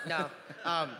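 normal talking voice

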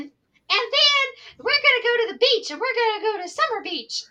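high, loud imitation of an excited kid; excited child's rant. Teacher imitates pupil telling his beach plans, excited rant. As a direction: gush it like a child on a roll.

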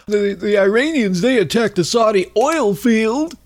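high, sing-song parroting voice; mocking talking point. Host mocking a right-wing talking point, high parroting. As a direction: parrot the pundit line in a silly high voice. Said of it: "the other talking point is".

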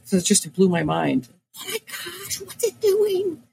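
usual speaking voice

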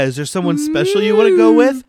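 sing-song high mother; high mom voice. Retold or imitated. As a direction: gush like a sweet mom. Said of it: "So his parents".

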